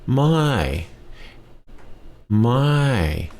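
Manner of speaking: mai with a clear falling tone. Shown as a demonstration. Teacher demonstrating the falling tone on mai. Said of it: "Falling tone".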